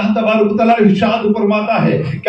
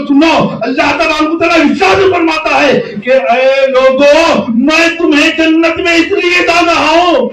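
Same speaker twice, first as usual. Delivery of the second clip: louder, higher sermon cry, voice breaking; impassioned preaching; 5 semitones higher, 5 dB louder than usual. Real, not acted. Preacher voicing God's promise to the crowd, impassioned cry. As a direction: pour it out, shouting and near tears.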